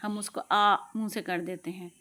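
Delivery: usual speaking voice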